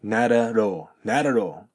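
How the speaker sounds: "Not at all" with both T's turned to soft D flaps. Shown as a demonstration. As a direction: say "Not at all" like "nah-da-dall". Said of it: "ソフトDに変わる".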